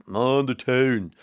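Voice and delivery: slow Mon the town, Dundalk style; Dundalk accent. Shown as a demonstration. Presenter gives the corrected Dundalk way, slowly. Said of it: "I was corrected ... by someone in Dundalk".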